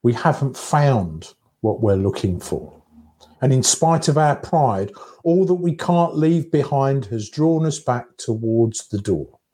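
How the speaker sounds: ordinary talk, nothing marked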